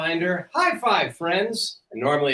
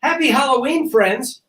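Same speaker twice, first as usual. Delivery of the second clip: excited greeting; 13 semitones higher, 8 dB louder than usual. Real, not acted. Cheery sign-off.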